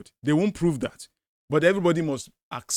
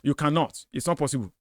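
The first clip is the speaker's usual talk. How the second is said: ordinary talk, nothing marked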